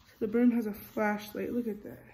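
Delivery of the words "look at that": plain reading voice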